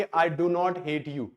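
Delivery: very slow, every word do not spelled out; slow full form. Shown as a demonstration. Teacher showing the unwanted full form, very slow. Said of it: "मुझे ये नहीं कहना होगा कि".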